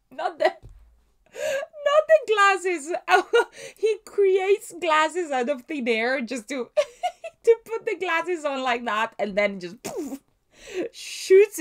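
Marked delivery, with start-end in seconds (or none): 0.1-8.8 higher and louder, slow, laughing so hard it squeaks out; real helpless laugh-crying through the words; crying with laughter. Real, not acted. Watching the glasses gag, she breaks into crying laughter.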